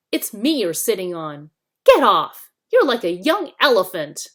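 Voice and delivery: loud, furious Get off; angry shout. A narrator's reading. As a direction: bellow in outrage. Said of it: "came an angry voice".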